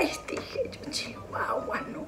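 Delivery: hushed, breathy aside; whispered dismay. Retold or imitated. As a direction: whisper it in disbelief. Susurro unvoiced, exclamación. (susurrando con asombro)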